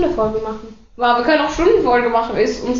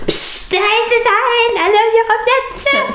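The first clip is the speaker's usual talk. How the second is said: a hiss of psst, then a much higher, slower call to order; teacher quieting pupils, hammed up high; mock teacher hushing; 10 semitones higher, slower than usual. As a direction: play a teacher shushing the class. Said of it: "Und dann gibt's immer".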